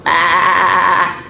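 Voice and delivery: loud, quavering baa; long bleat on one note; little sheep's bleat. A narrator's reading. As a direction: imitate a lamb bleating. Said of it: "says the little sheep".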